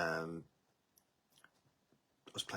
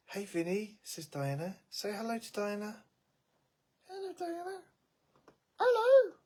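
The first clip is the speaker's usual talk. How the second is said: pet talk greeting; 13 semitones higher, 5 dB louder than usual. Real, not acted. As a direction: coo it way up high at the pet.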